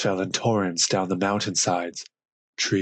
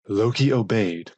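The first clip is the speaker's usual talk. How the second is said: ordinary delivery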